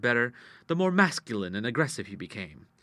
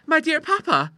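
high, louder, remonstrating daughter; lightly playful daughter; 7 dB louder than usual. Acted. Daughter answering her shocked father, high-pitched remonstrance.

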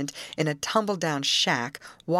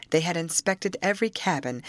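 ordinary delivery, nothing marked

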